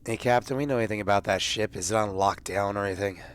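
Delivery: ordinary talk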